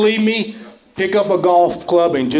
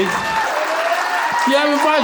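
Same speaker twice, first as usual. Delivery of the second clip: calling to crowd; 9 semitones higher, much slower than usual. Real, not acted.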